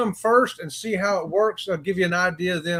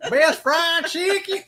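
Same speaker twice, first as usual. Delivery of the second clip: laughing, higher mock Southern drawl; imitating Southern drawl; 10 semitones higher, slower than usual. Friend laughingly copies her Southern drawl. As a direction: echo her drawl through a laugh, slowly. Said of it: "Listen to that Southern drawl".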